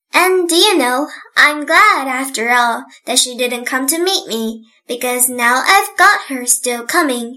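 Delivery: usual speaking voice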